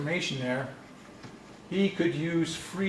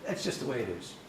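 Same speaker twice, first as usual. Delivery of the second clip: softer, frustrated uncle impression; imitated frustration; 3 semitones lower, 6 dB quieter than usual. Uncle pressed on grammar by a student, frustrated. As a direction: give up explaining, mildly frustrated. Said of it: "I said it this way".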